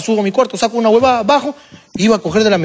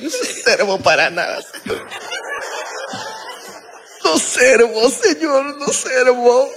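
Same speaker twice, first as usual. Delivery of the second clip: lamento exagerado y lento; empleada autocastigándose; 3 semitones higher, 4 dB quieter, much slower than usual. Retold or imitated. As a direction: imitar un lloriqueo arrepentido. Imita a Juanita autocastigándose. (parodia quejumbrosa de empleada culpable)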